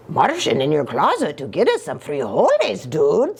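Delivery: low voice with swooping peaks; playful character voice. Acted. Mystery voice answering Scapito from the closet, low swooping character voice. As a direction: swing the pitch in a cartoonish accent.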